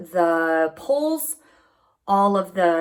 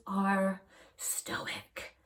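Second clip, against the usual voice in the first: whispered aside; 12 dB quieter than usual. Real, not acted. Deadpan aside about New Yorkers.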